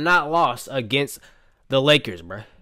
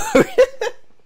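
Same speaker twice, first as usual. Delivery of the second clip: high, squealing laughter; cracking up; 3 dB louder than usual. Real, not acted. Fan cracking up on himself, helpless laughter. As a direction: lose it laughing at yourself. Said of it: "Got me over cracking up on myself".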